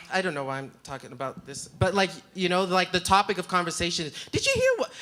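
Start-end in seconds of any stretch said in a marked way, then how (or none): 4.3-4.9 high squeaky mom voice, gossiping; mom imitation. Imitating mom gossiping about the news, high squeak. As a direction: pitch way up into a mock mom. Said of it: "sorry, that wasn't her accent".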